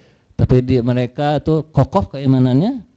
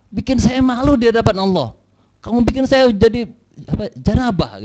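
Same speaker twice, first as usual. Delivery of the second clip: higher-pitched mock scolding; retold angry reproach; 7 semitones higher than usual. Dead woman reproaches the young man in his dream, mildly angry. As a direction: reproach him for shaming her. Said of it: "datang marah-marah".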